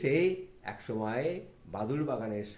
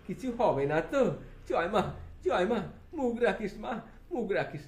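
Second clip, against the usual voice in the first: Thakur in awe; 9 semitones higher, 5 dB louder than usual. Acted. Thakur gazing at the big house, lightly voiced awe.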